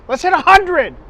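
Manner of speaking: rally the viewers with a cheer; excited. Real, not acted.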